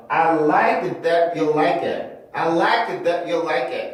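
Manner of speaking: "that you" split, T stopped before "you"; cut-off T. Shown as a demonstration. Teacher showing the second method, T cut off. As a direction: cut "that" short, then a clean "you". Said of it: "그냥 끊어서 발음을 해주는 거".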